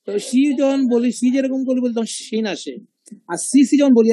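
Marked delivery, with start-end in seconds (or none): none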